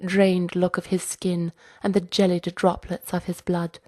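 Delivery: plain reading voice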